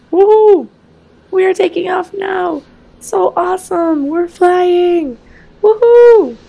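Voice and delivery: high, loud squeals of joy; excited kid cheer. Acted. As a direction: cheer like a thrilled child. Kids cheer at takeoff.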